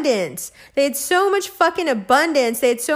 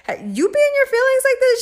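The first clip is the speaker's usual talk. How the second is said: high incredulous question; 13 semitones higher, faster than usual. Retold or imitated. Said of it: "looking at my girlfriends like".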